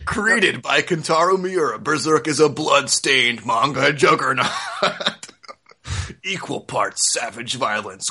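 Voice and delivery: dramatic trailer-announcer read, breaking into laughs; mock epic voice-over, barely holding it together; hammed-up blurb voice. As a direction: sell it like an over-the-top back-cover blurb. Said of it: "Do, do the voice".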